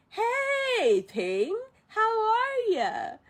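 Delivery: high, drawn-out mock-friendly greeting; teasing hail. Ling greeting Mulan arriving late, teasing and drawn out. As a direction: stretch the hello out high, then swoop down.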